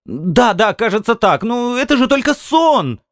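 very high, pleading woman's voice; wife's exasperated protest. A narrator's reading. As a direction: protest as a wife, pitch climbing high. Wife gives in, protesting. (высокий голос жены, оправдываясь)

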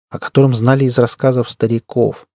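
usual speaking voice

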